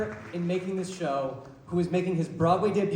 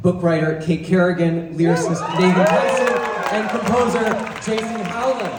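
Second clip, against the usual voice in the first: loud, raised announcing voice; rousing introduction; 3 semitones higher, 6 dB louder than usual. Real, not acted. Presenting the show's writers to the crowd, rousing introduction. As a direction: call out each name for applause.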